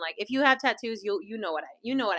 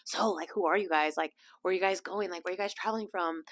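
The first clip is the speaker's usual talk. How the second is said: soft, low, hushed questions; a hint of a nosy neighbour; curious lady; 3 semitones lower, 7 dB quieter than usual. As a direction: lean in and ask quietly. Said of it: "she's like".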